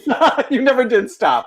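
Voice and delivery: laughing. Real, not acted. Host teasing the guest about never stopping acting, laughing. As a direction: tease her through real laughter.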